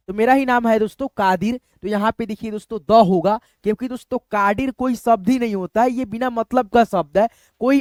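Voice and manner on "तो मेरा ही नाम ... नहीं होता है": ordinary talk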